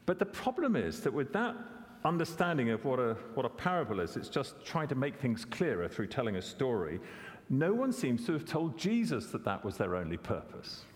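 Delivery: ordinary talk, nothing marked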